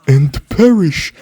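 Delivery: slow, rising menace on perish; game villain voice line. Retold or imitated. Gamer quoting a Paladins ultimate to the viewers, slow and ominous. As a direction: stretch out perish like a doom-bringing champion. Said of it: "the guy that would say".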